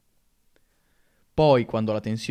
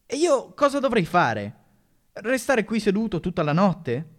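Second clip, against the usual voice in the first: higher, lightly voiced stunned boy; astonished boy; 7 semitones higher than usual. Barry to the teacher, stunned and indignant. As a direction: gasp it out in disbelief. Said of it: "Barry era sbalordito".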